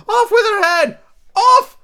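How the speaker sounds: very high, loud shriek; screaming Queen. A narrator's reading. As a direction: scream the order in royal fury. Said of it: "she began to scream".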